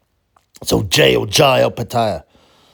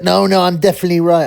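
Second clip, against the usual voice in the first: higher, insistent mock whine; mocking stubborn subscriber; 7 semitones higher, 4 dB louder, faster than usual. Vlogger mocks stubborn ex-subscriber: higher mock whine. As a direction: play the sulky know-it-all, pitched up. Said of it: "he still got all upset".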